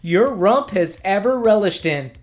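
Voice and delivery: mock commercial announcer voice; ad read. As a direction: sell it like a TV ad. Reads sponsor ad.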